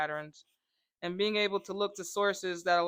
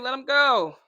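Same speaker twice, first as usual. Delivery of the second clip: firm command; 4 semitones higher, 9 dB louder than usual. Retold or imitated. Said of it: "And I said".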